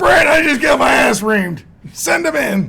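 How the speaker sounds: high, loud half-laughing yell; mock panicked shout. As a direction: shout it like a reporter who just got chewed out. Mock reporter after coach rant.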